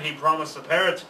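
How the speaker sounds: plain reading voice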